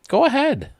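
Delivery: high goofy guy. Acted. Guy in elevator answering woman, high goofy voice. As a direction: squeak it out high.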